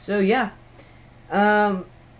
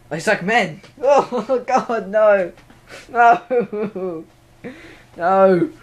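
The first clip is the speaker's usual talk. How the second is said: helpless laughter, higher and louder on the protests; laughing; 4 semitones higher, 7 dB louder, much slower than usual. Real, not acted. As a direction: crack up while protesting in embarrassment. Cracks up at own slip.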